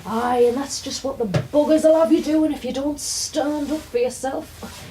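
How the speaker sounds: put-on gruff old northern granddad, loud and then slowing; a light turn as a lecturing old granddad; grumpy granddad. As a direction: do granddad putting her right. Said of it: "my granddad goes mental about".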